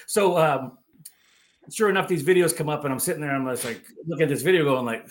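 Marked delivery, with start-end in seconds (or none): none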